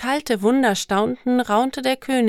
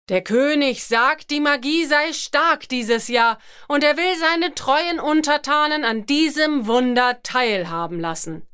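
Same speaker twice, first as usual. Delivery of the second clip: booming thunder voice; 5 semitones higher than usual. Acted. Herald proclaims again.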